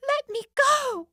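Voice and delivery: very high loud scream; desperate cry. Acted. Nancy struggles against Sid, screaming to be let go. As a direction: fight to break free. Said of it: "Nancy cried".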